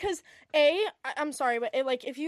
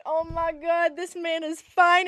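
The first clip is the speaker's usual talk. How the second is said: gushing; 5 semitones higher, 8 dB louder, slower than usual. Retold or imitated. Gushing over a hot guy.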